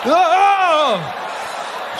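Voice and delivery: high laughing shout of delight; a gleeful high whoop. Pretend. Joyful cry at the spank.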